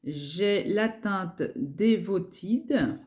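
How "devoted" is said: "Devoted" said the English way, not French; brand name "Devoted" in an English accent. Real, not acted. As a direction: pronounce "Devoted" as an English word, slow and deliberate. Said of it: "pardon l'accent anglais".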